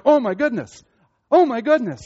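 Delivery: high, loud exclamations of wonder; mind-blown, voice leaping up and falling; awestruck amazement. Acted. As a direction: gasp it out, overwhelmed by glory. Acting out endless amazement at glory.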